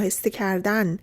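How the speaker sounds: ordinary delivery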